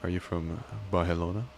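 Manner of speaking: usual speaking voice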